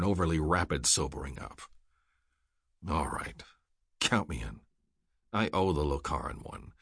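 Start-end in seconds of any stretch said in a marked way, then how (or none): 2.9-6.7 low, soft, resigned consent; subdued agreement. A narrator's reading. Rourke agrees because he owes the Lokaran one, subdued. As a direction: give in quietly, voice dropped low.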